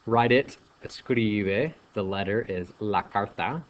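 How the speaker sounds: ordinary talk, nothing marked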